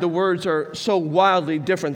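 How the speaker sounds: ordinary delivery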